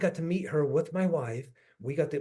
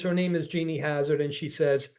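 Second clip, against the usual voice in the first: usual speaking voice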